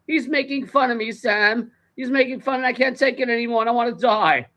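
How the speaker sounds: high-pitched mock distraught complaint; retold tearful wail. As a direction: imitate a friend pouring out misery. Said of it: "she couldn't stop, like, sobbing".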